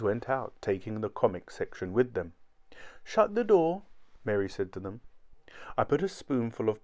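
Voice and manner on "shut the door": higher-pitched wife giving an order; lightly voiced wife, high pitch; wife's voice. A narrator's reading. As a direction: send them out in a high voice. Wife calls to the boys.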